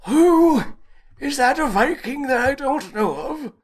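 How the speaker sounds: absurdly high mock Viking commander; Viking accent. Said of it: "You sound like old Vargelia".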